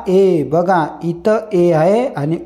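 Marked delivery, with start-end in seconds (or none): 0.1-0.4 "ए" as eh, not ee; phonic sound of E. Shown as a demonstration. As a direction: sound out the letter E as "ए". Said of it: "ईचा उच्चार करायचा".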